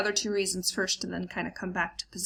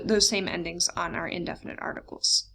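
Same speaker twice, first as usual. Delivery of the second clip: ordinary delivery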